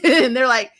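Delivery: ordinary delivery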